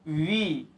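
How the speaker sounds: वी with a drawn-out E; long vowel. Shown as a demonstration. Teacher modelling a long vowel after a consonant, वी with long E. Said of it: "लंबी होगी।".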